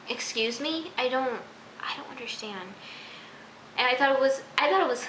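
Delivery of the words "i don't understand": exasperated whisper; whispered disbelief to herself. Real, not acted. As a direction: drop to a breathy, voiceless whisper. Frustrated aside.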